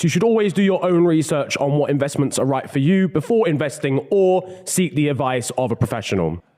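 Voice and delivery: ad disclaimer read. Acted. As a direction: read the legal small print. Reads ad disclaimer.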